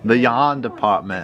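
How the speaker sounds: slow Boston "yahn depahtment"; mock Boston accent. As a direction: drop the Rs like a Bostonian. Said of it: "If you in Boston".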